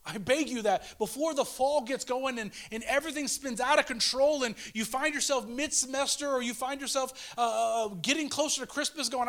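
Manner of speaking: high, loud pleading preacher; preaching at full force. Acted. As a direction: preach it, pushing the pitch up. Pleading climax of the sermon.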